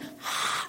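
failed attempt at sound, only breath; voiceless exhale. Comic showing she couldn't even manage that, airy exhale. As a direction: imitate a completely lost voice. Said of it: "I couldn't even do that".

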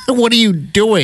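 high, startled what-are-you-doing; incredulous wife. Retold or imitated. Wife catching husband tearing up at football, startled. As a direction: catch him red-handed, half laughing.

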